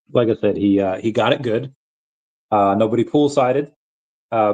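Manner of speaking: usual speaking voice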